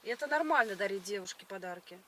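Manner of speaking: usual speaking voice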